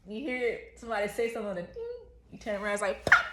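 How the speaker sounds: loud, high, laughing retelling; excited laughing. Real, not acted. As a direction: tell it loudly through laughter.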